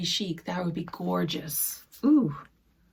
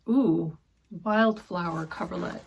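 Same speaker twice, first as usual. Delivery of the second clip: ordinary delivery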